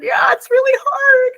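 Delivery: hammed-up little boy, high and loud. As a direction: whine it like a sad kindergartner. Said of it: "And he said".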